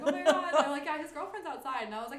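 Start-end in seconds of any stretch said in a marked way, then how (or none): 0.0-0.5 loud, high excited outburst; starstruck shout. Retold or imitated. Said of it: "so starstruck". 0.8-1.8 excited. Retold or imitated. Retold fans' reply.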